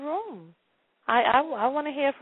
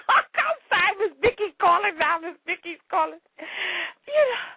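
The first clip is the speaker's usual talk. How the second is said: high-pitched, helpless giggly apologies; laughing hard; 7 semitones higher than usual. Real, not acted. Host apologizing as the caller rings in, still cracking up. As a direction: struggle to recover from a real laughing fit. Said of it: "I'm laughing so hard".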